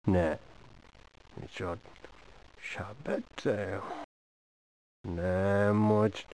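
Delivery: a hushed plea to the quiet one; pleading hush. Acted. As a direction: lean in and beg them softly to talk. Begging silent audience to speak.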